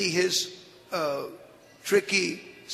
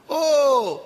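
loud greeting; 7 semitones higher, 6 dB louder than usual. Acted. Disguised Narayan greets demon.

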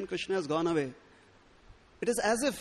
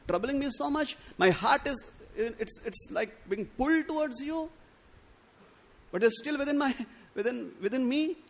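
raised, pleading bewildered servant voice; perplexed servant; 5 semitones higher than usual. Acted. Servant pleading to Radha, pitched up and bewildered. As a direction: plead high and helpless, all at a loss.

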